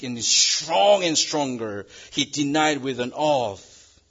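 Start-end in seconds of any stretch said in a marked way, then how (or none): none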